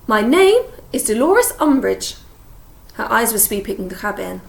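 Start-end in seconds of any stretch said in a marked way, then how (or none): 0.1-2.2 slow, higher, prim lady voice; prim introduction. Acted. Umbridge to Hagrid, slow prim self-introduction. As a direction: announce yourself with prissy poise.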